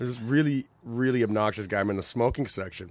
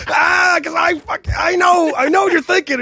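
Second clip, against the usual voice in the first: mock accusing shout; 13 dB louder than usual. Teller mock-accusing the stranger.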